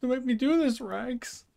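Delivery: pleading. Acted. Pleading with dog in game.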